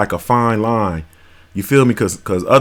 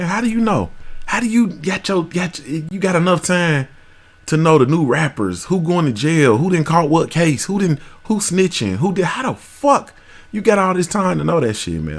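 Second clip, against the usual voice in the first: incredulous; 6 semitones higher than usual. Real, not acted. Incredulous rant at gossipers.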